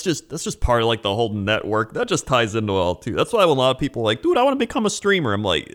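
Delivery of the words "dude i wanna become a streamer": high, quick naive voice; mock eagerness in a raised pitch; eager would-be streamer. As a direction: imitate a starry-eyed newcomer, fast and high.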